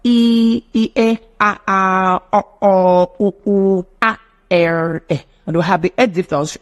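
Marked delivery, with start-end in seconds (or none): 0.0-5.2 drawn-out pure vowel sounds; vowel demo. Shown as a demonstration. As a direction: say each vowel cleanly, one by one. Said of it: "the twelve pure vowels".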